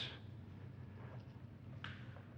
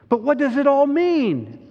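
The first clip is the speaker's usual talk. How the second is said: put-on high, loud girl's question; high-pitched, emphatic puzzlement; bewildered Susan; 13 semitones higher, 5 dB louder than usual. A narrator's reading. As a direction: voice an overwhelmed girl wanting answers. Susan's urgent question in story.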